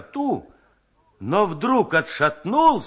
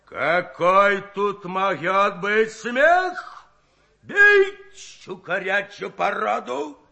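fierce yell; 4 semitones higher, 3 dB louder, much slower than usual. A narrator's reading. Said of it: "свирепо заорал".

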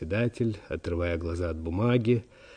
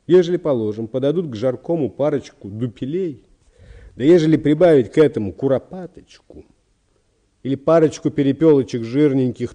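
louder, higher, insistent gourmand; secretary enthusing over food; 6 semitones higher, 9 dB louder than usual. Acted. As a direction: talk the sick man into eating with rising fervour. (секретарь-гурман, громко и увлечённо уговаривает)

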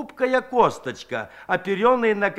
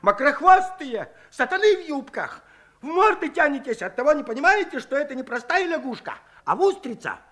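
higher, sharp scolding cry; angry old man's shout; 8 semitones higher than usual. A narrator's reading. Fed-up Shchukar shouts at the women, higher and scolding. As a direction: lash out at the women. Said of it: "Щукарь не крикнул".

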